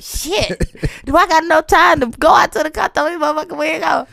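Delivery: high, loud exasperation; exasperated joking rant. Retold or imitated. Singer mocking the idea of fetching her wig, exasperated. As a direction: throw up your hands loudly. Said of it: "y'all like".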